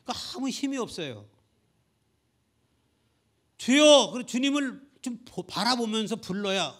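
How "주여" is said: full-voiced, high shout of "주여"; loud cry. Shown as a demonstration. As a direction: cry out "주여" with all force. Demonstrates empty loud calling. (힘껏 외치는 "주여")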